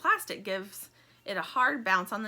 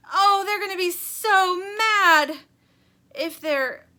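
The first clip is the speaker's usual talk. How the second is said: high, loud, slow toy voice in dismay; Stingray aghast and high-pitched; fretting Stingray; 11 semitones higher, 12 dB louder, slower than usual. Acted. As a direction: wail it out in horror. Stingray's voice in story.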